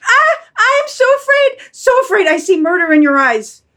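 very high, loud wail of fear; high-pitched terrified pleading. Acted. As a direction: shriek it as the panicked dog. Dog flees murderous master.

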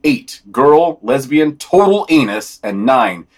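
ordinary talk, nothing marked

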